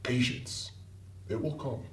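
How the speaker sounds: soft, breath-light counsel; hushed reassurance. Acted. Club leader telling a recruit to wait, hushed. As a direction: quietly hold them back.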